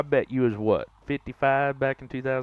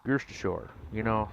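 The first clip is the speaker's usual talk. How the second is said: usual speaking voice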